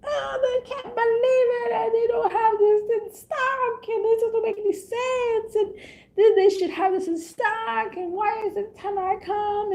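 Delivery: high, wailing mock complaint with a sob; imitating a shopper's high tearful meltdown; whiny shopper freak-out. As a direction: whine it like a shopper near tears. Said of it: "And they're like".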